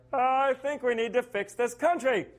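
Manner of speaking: mock nasal high Lincoln voice; high-pitched nasal Lincoln. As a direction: squeak out the speech like a reedy Lincoln. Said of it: "high-pitched, nasally voice".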